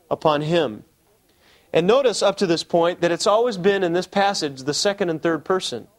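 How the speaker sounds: usual speaking voice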